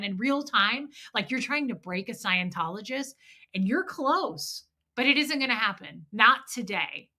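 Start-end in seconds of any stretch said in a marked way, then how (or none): none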